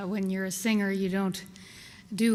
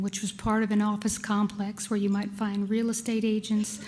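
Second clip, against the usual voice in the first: usual speaking voice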